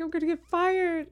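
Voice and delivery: high panicked wail; mock panic. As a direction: squeal it like you're doomed. Retold panic.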